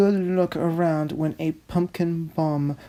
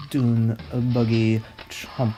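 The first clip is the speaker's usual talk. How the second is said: usual speaking voice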